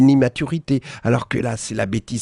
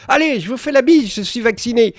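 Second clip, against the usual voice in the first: much higher, squeaky mock fan voice, a bit louder; 12 semitones higher, 4 dB louder than usual. Columnist mocks a fan greeting Dupont-Aignan, high and gushing. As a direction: play the gushing fan lady, pitched way up. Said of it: "Et elle lance à Nicolas".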